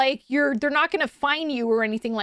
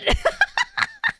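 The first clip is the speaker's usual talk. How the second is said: high-pitched cracking up; laughter. Real, not acted. Storyteller cracks up at the curveball, laughing high. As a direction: burst into helpless high laughter.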